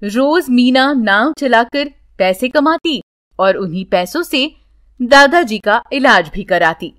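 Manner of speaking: plain reading voice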